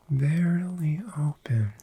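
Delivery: usual speaking voice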